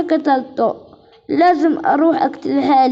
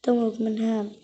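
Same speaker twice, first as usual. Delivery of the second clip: softer, lower hushed voice; hushed sneaking; 4 semitones lower, 6 dB quieter than usual. Acted. Girl sneaking to bury her brother in secret, hushed lower voice. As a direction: keep it hushed so nobody hears.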